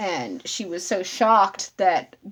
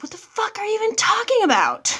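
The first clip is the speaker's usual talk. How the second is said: high-pitched, indignant retort; outraged disbelief; 13 semitones higher than usual. Retold or imitated. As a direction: fire back in exasperated disbelief, pitch shooting up. Retelling outraged reply to a notification.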